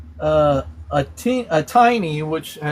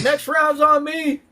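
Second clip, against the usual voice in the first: mock pub shout, much higher and slower; playful shout; 12 semitones higher than usual. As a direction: belt it out like buying the whole pub a round. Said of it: "I'm gonna shout".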